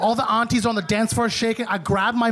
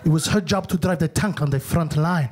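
imitating uncle; 6 semitones lower than usual. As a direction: lower matter-of-fact uncle voice. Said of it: "He's like".

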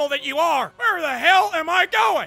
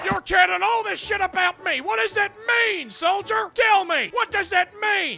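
barking drill sergeant berating a recruit; angry drill sergeant; 3 semitones higher than usual. Acted. Drill sergeant chewing out a player in the lobby. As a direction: play the foul-mouthed sergeant.

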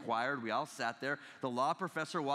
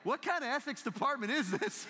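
mock disbelief; 8 semitones higher than usual. Shocked reply to caller.

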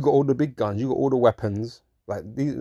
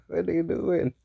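mock shock; 7 semitones higher than usual. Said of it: "Everyone's like".

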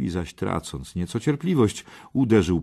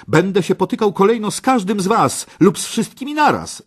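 very high, loud challenge; defiant cry; 13 semitones higher, 8 dB louder, faster than usual. A narrator's reading. Parsival challenges all the remaining knights, defiant high cry. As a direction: throw down the challenge to all comers. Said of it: "zawołał".

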